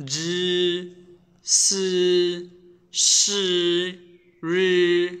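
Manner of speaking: জি সি রি said with the tongue curled back; teacher drills জি সি রি with the tongue tip curled up; Chinese retroflex demo. Shown as a demonstration. Teacher to learners, curling the tongue for জি সি রি. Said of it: "ওই জায়গায় জিহ্বাটা রেখে এগুলো উচ্চারণ করবেন".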